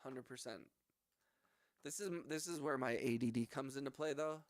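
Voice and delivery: ordinary talk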